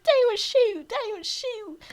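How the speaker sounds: high, loud, frantic pleading; panicked old farmer. Retold or imitated. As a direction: beg for your life, hands up. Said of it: "and he's like".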